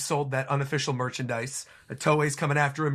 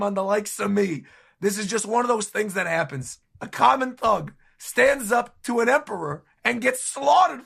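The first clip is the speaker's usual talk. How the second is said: raised, louder tearful plea; Bartolomeo exclaiming; 7 semitones higher, 4 dB louder than usual. Acted. Bartolomeo confessing to Shanks, raised and pleading. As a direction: cry it out as the doomed Bartolomeo. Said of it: "Bartolomeo exclaims".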